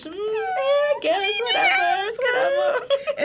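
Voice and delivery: high, drawn-out mock bashfulness; hammed-up sheepish brush-off. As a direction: squirm away from the compliment in a squeaky, laughing voice. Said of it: "Like, sheepish".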